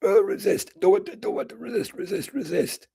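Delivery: high, strained chant of resist; a lightly whiny voice refusing to cry; resisting inner voice. Acted. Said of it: "at first it's resistance".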